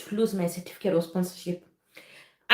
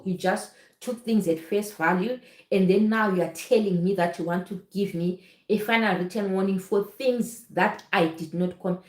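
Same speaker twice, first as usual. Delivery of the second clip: ordinary talk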